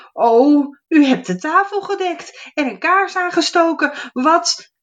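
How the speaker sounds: high, loud amazed gnome voice; surprised gnome. Acted. Gnome amazed the fairy set the table, high excited voice.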